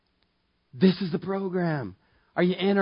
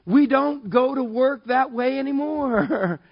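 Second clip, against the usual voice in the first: full preaching; 7 semitones higher than usual. Acted. Preacher presses the verse on the congregation, fervent. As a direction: preach it with fire.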